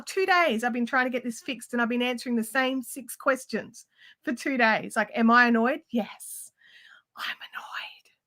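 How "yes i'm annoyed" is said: breathy whispered admission; whispered, fed-up aside. Real, not acted. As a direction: drop to a hushed whisper to confess it. Admitting annoyance, dropping to whisper.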